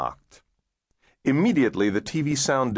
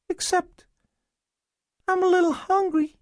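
put-on very high, small child's voice; tinsel-voiced little boy. A narrator's reading. Starving boy answering caseworker, tinsel voice. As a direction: answer in a thin tinsel treble. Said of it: "he said in a tinsel voice".

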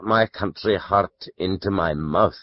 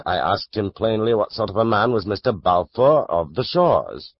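ordinary delivery, nothing marked

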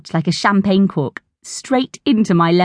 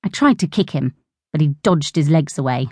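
plain reading voice

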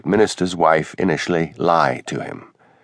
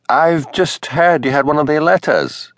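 higher, slower voice of the minister's wife; 7 semitones higher than usual. A narrator's reading. Narrator voices Mrs Wellington.

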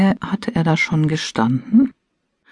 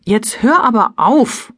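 higher-pitched protest, cutting in; indignant interruption; 8 semitones higher, 4 dB louder than usual. A narrator's reading. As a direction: cut the partner off indignantly. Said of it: "unterbrach ich ihn". (empört unterbrechend)

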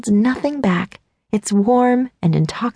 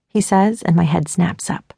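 ordinary delivery, nothing marked

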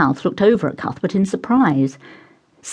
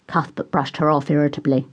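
plain reading voice

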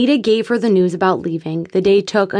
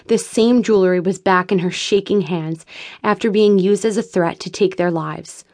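usual speaking voice

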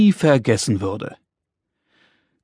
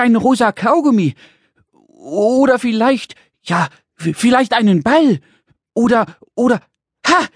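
high, giddy little creature's voice; excited little man; 7 semitones higher than usual. Acted. Little man guesses what Dad will buy, excited. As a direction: guess away, bursting with excitement. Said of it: "hüpfte der kleine rosa Mann aufgeregt".

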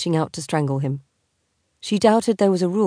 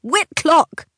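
shrill screech, much higher and louder; wife screeching at her husband, loud; 12 semitones higher, 5 dB louder than usual. Acted. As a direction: shriek his name in outrage. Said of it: "she screeched".

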